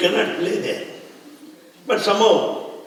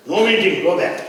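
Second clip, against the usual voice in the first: louder, higher, demanding questions; mock challenging shout; 4 semitones higher, 5 dB louder, faster than usual. Communist leader stopping the car, demanding loudly.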